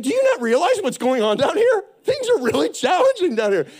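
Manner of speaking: high, loud mock indignation; acting out an affronted complaint; offended protest. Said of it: "you were a little offended".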